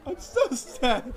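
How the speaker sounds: milk the fake tears; mock sobbing. Still clinging to the stranger, whimpering mock sob. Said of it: "And I started crying".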